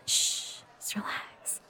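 very soft whispered shush; whispered reassurance to a partner; soothing hush. Acted. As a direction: hush them gently, barely voiced. Hushing partner in the booth.

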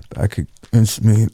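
normal talking voice

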